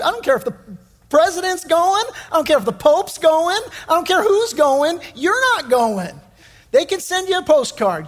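father shooting down daughter's trip, rising protest; mock indignant rant.

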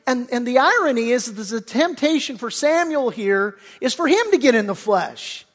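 usual speaking voice

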